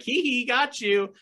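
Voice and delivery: high, slow mock giggly tease; squeaky put-on playfulness; playful teasing. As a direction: tease like a harmless prankster. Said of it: "It's not a fun, like".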